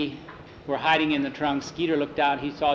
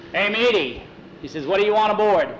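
louder, higher sailor hail; sailor calling out; 5 semitones higher, 4 dB louder, faster than usual. Acted. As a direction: call across the dock like a rough sailor. Sailors call out at dock.

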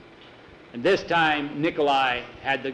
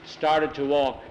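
usual speaking voice

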